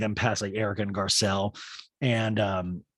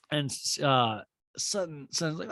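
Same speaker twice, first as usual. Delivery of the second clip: ordinary talk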